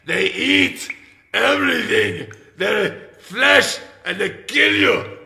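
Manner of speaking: ordinary talk, nothing marked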